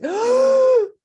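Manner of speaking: high, loud fearful gasp; sharp gasp. Retold or imitated. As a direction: suck in air in sudden fright. Ego panics on steeper slope.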